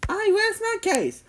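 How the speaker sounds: high-pitched stern mother voice; stern mom. A narrator's reading. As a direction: lay down the law in a high voice. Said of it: "Jimmy's mom said sternly".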